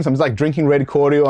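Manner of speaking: ordinary delivery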